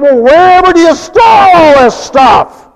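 high, loud hammed-up question; mock shouting friend. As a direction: blurt it out like an amazed friend.

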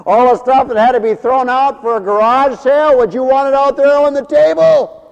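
high, loud preacher's challenge; full-force preaching. Acted. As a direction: put it to the congregation loudly.

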